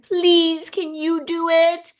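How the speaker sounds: high, drawn-out pleading imitation; high-pitched wheedling plea; pleading child. Child begging for fish food.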